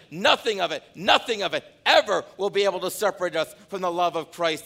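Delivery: high, loud pulpit preaching; preaching at full force. Acted. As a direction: preach it out to the whole room.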